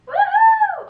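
high, loud woohoo; excited whoop. Real, not acted. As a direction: cheer at the lights. Lights turn on.

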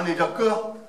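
normal talking voice

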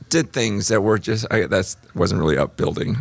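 normal talking voice

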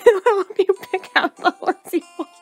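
real giggles breaking up the accent; laughing through lines. Real, not acted. Uncle sending niece to market, player helplessly laughing. Cracks up while voicing the uncle.

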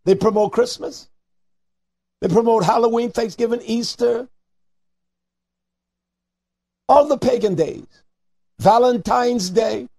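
high, loud, rising sermon cry; fiery preaching. Acted. Preacher scolding listeners over holiday merchants, fiery and high. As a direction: hammer the crowd with every line.

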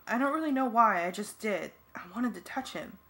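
plain reading voice

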